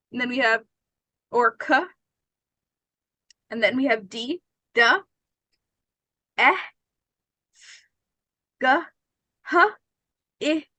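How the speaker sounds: letters sounded out one by one, mouth open; letter sounds demo. Shown as a demonstration. Teacher sounds out the alphabet for learners. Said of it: "let's think about it".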